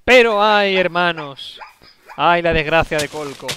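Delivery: loud mock sermon lament; preaching. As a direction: preach it to the brethren.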